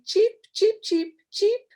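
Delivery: very high, tiny chick peeps; chick cheeping. Retold or imitated. Teacher voices the little chick for young learners, high peeps. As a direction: peep it high like a newborn chick. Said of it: "a little chick in English will say".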